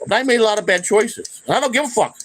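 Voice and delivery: high heated defiance; rising angry defiance; defiant. Real, not acted. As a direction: throw it out defiantly. Defiant rant.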